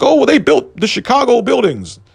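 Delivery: lightly caricatured high voice, a touch of smug breeziness. Retold or imitated. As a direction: toss it off high, like a pleased old-timer. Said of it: "And they'd be like".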